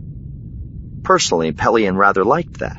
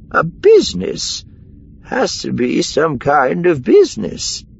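high, drawn-out eureka; sudden realisation; 12 semitones higher than usual. A narrator's reading. Miranda hits on the hideout, high and keen. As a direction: pitch way up as the idea lands.

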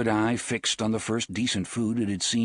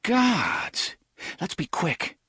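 uneasy youth; 9 semitones higher, slower than usual. Acted. Cuthbert unnerved by the thinny.

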